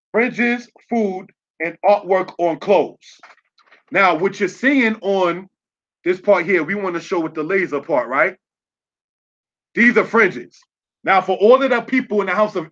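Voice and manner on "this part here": normal talking voice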